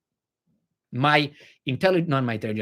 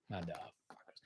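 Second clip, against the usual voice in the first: whisper; 9 semitones lower, 24 dB quieter than usual. Real, not acted. Muttered curse then aside.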